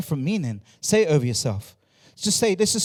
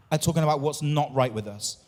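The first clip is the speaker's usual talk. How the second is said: ordinary talk, nothing marked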